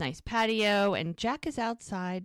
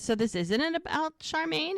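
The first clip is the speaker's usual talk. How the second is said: high-pitched acted probing; probing question; 9 semitones higher than usual. Podcaster voices woman asking about Charmaine, probing. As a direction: test him with a pitched-up question. Woman probes man's mood.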